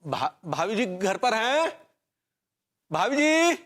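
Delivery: high, drawn-out call at the door. Acted. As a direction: call out to see who's home. Calling at neighbour's door.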